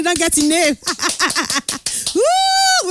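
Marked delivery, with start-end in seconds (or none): 0.8-2.1 quiet delighted chuckle; a short soft laugh of glee. Real, not acted. Host laughs as a listener guesses right, softly. 2.1-2.9 excited whoop. Real, not acted. Radio host cheering a listener's right answer with a whoop. Celebrating listener's correct guess.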